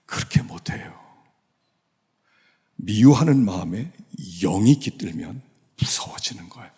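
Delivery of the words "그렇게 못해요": whispered, soft and grave; hushed repeat. Real, not acted. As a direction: drop to a breathy whisper. Repeats the point in a hush.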